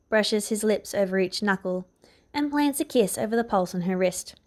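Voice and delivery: ordinary delivery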